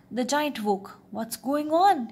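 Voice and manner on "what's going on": high, loud giant's cry; raised, alarmed storybook voice; startled cry. A narrator's reading. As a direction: cry out as a giant jolted awake. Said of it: "he cried".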